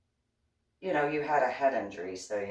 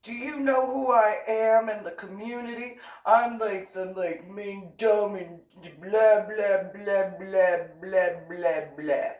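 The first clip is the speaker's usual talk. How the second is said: higher, louder self-important boast; mock pompous man; 7 semitones higher, 6 dB louder than usual. Mocking a self-important man bragging about his status. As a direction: puff up like a local big shot.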